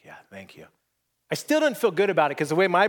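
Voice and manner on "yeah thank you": very soft, low sighing thanks; small, drained voice imitating her; weary sigh. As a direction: sigh out a faint reply. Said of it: "she just kinda sighs".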